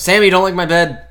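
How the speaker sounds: loud call to the dog; yelling. Real, not acted. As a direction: snap loudly at the dog. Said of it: "You just yelled".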